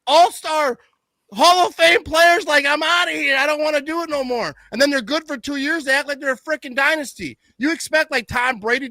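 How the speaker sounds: high-pitched, exasperated rant; heated rant. Real, not acted. Fan mocking Lions fans' dynasty talk, high and heated. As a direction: keep railing at the rivals, voice pushed way up.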